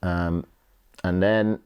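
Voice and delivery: ordinary talk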